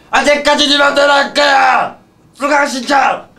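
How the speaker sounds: high loud exasperated yell; frustrated shout. Acted. Frustrated outburst title.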